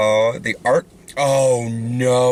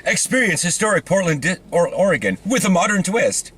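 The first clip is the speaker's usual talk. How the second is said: higher-pitched mock promotional voice; parody ad read; 7 semitones higher than usual. Parodying how Portland gets described, mock ad read. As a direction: spoof a marketing slogan, pitched up.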